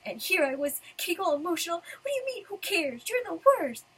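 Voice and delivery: high, indignant outburst; mad Lucy. Acted. Lucy telling Natsu off for ruining the moment, mad. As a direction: build to a loud, scolding finish. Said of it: "she's mad".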